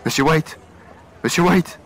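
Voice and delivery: urgent shout at a door; calling out. Acted. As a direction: call loudly for help.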